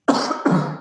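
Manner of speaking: harsh throat-clearing cough; coughing fit. Real, not acted. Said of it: "Got the beer cough".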